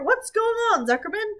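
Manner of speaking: announcer suddenly alarmed; alarmed loudspeaker. A narrator's reading. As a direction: shout the worry across the ring. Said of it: "asked the loudspeaker".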